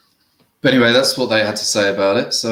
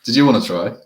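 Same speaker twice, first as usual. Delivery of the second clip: ordinary talk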